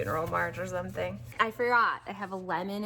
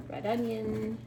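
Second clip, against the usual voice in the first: ordinary talk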